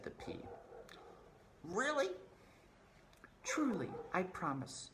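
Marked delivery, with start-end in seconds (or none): 1.6-4.9 high character voices. Acted. Question and promise.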